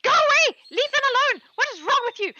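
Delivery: high, loud goblin shouting at the hawk; angry goblin yell. Acted. Goblin shouting at the hawk to leave chickens alone, high and loud. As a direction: shoo the hawk off in a shrill goblin screech.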